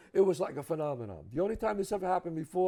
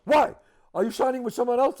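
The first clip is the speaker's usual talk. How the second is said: suddenly higher and louder, anxious; he's scared to lose the client, so he fires it out; alarmed agent; 7 semitones higher, 8 dB louder than usual. Retold or imitated. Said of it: "The head of William Morris".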